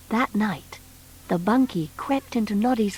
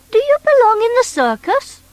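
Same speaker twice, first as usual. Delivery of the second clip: put-on high, bright little toy boy; Noddy's voice; 13 semitones higher, 5 dB louder than usual. Noddy asking the strange creature, high boyish voice. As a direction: pitch up as young Noddy.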